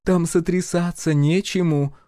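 drawn-out nasty put-down; spiteful grumble. A narrator's reading. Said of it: "злобно буркнула Гюрза".

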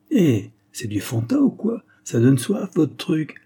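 cheeky guy teasing the chemists about a red flask; mocking grin. A narrator's reading. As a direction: toss it out with a smirking grin. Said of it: "avec un sourire goguenard".

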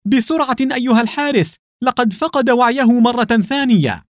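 high urgent call for help; a woman yelling high and loud for the guard to hurry; shouting for the guard. Acted. Mona shouting high and loud to the guard for help. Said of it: "سمع الحارس صوت منى وهي تصيح".

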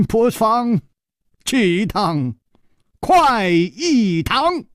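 high, teasing sing-out; amused. A narrator's reading. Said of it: "张啸林笑着说".

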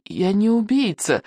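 put-on scared boy's faltering protest; mumbling, trembling voice. A narrator's reading. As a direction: plead innocence in a small trembling voice. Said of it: "промямлил Икинг дрожащим голосом".

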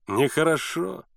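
lilting mock reproach, rising at the end; smug, sing-song disapproval; insolent smile. A narrator's reading. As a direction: taunt through a smile, voice lifting. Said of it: "нагло улыбаясь, повторил лодочник".

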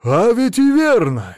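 loud, high, drawn-out exclamation; amazed high outburst; sudden realisation. A narrator's reading. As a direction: cry out as it dawns on you. Sudden realisation, slaps forehead.

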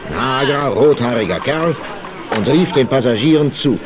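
ordinary delivery, nothing marked

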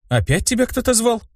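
high, alert question; perking up. A narrator's reading. Girl hearing her companion called, perked-up question. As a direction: perk up curiously, pitched high. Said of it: "встрепенулась Улаирк".

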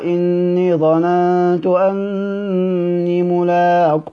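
very slow tajweed chant; Quranic recitation. Shown as a demonstration. Teacher quoting a verse to students, slow recited chant. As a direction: lengthen each word as in tarteel. Quran words quoted in lesson.

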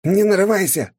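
резкий высокий окрик, угрожая; осадить наглеца сверху вниз; угрожающий голос мага. A narrator's reading. Ice mage threatens hero. (угрожающий окрик)

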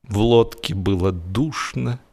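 ordinary delivery, nothing marked

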